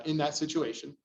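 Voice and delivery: ordinary delivery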